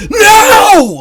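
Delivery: high, loud desperate scream of no. Shown as a demonstration. Herrick screaming from the water. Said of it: "like, scream it".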